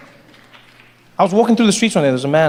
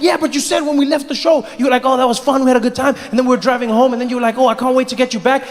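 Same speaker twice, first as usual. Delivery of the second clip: put-on high complaining boyfriend; whiny boyfriend; 7 semitones higher, faster than usual. Boyfriend complaining to girlfriend about the drive home, high whine. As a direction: argue it like a needy man.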